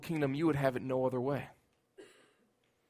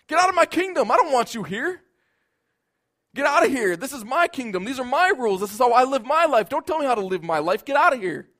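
loud, high furious shout of a ruler; angry shouting king; 8 semitones higher, 7 dB louder than usual. Acted. Preacher voicing a selfish king driving out an intruder, angry shout. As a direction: bark him out of your kingdom.

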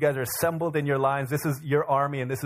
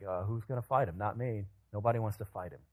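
much softer, shrinking back; timid mumble; 5 semitones lower, 8 dB quieter than usual. Retold or imitated. Frightened Israelites answering the giant's challenge, very soft and timid. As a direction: say it like you hope nobody hears.

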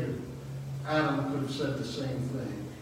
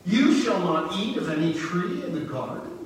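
casting doubt; 5 semitones higher, 4 dB louder than usual. Acted. Serpent speaking to the woman, acted doubting. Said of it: "casting doubt on God's word".

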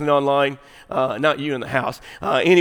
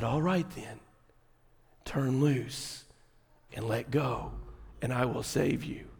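very soft, measured heavenly voice; hushed and low, pausing between each instruction; voice from the sky; 4 semitones lower, 14 dB quieter than usual. Acted. Voice from sky tells man let go, hushed divine command. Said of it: "a final word from the voice from the sky".

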